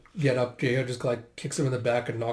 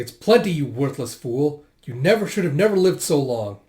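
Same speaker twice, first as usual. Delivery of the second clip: louder, indignant berating; angry scolding; 2 semitones higher, 6 dB louder than usual. Acted. Elder berating Garo over the food, angry and loud. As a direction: snap at a worthless fool, voice raised.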